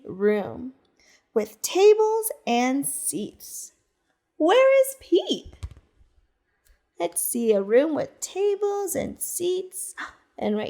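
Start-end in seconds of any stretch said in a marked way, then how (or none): 4.4-5.5 high, louder teasing question; playful question. Acted. Storyteller quizzing children, playfully high. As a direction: quiz the kids brightly.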